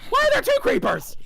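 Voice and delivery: very high, loud startled shriek; real high-pitched yelp of disbelief. Real, not acted. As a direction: shriek it in alarm at a sudden threat. Startled by two creepers in game.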